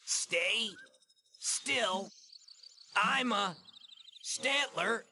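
halting, one word at a time; spaced-out words, long pauses between; coaxing animal imitation. Brock poses as a Stantler to the skittish fawn, halting and coaxing.

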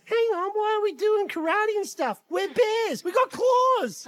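put-on high childish realisation, building; put-on excitable little bear; excited Baby Bear. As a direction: pipe up, then crow the last words. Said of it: "but Baby Bear's like".

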